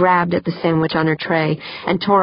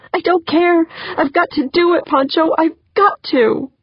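high-pitched, tearful insistence; desperate plea; 10 semitones higher than usual. Acted. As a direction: plead as if close to tears. Tearful plea despite the danger.

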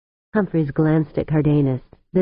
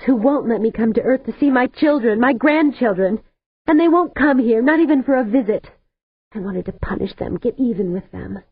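bitter tearful outburst; 8 semitones higher than usual. A narrator's reading. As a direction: spit it out through tears. Weeping Cardenas vents her bitterness.

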